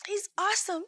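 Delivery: high, drawn-out jubilant shout; exuberant praise. Retold or imitated. Nurse seeing miracles spark out, exuberant praise. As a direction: re-enact joyful praise, stretching it out high.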